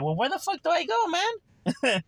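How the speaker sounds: high-pitched, comic wail of bewilderment; mock exasperation. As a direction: play a lost misfit in a squeaky high voice. Voices the baffled misfit, then laughs.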